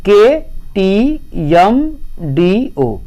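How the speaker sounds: "के टी एम" said letter by letter, very slow; spelled out slowly. Shown as a demonstration. Teacher spelling a mnemonic for students, slow letter by letter. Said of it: "KTM, इसको याद रख लीजिए".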